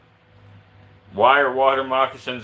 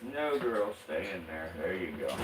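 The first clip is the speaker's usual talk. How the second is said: soft coaxing; 6 semitones lower, 13 dB quieter than usual. Real, not acted. Coaxing snake back into enclosure.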